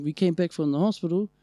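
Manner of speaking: normal talking voice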